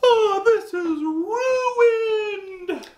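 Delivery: high, slow whining wail; whiny kid. Retold or imitated. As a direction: whine like a spoiled kid. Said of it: "if you're a whiny little turkey, you'd be like".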